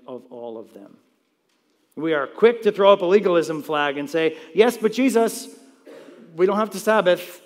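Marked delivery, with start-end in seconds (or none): 4.5-7.4 high, loud objector's voice; mock protest. Christians objecting to the pastor about legalism, mock protest. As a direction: imitate someone raising a loud objection.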